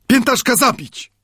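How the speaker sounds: high, impetuous outburst; vehement Friday. A narrator's reading. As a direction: blurt it high and fierce. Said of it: "zawołał gwałtownie". (gwałtownie, wysokim głosem)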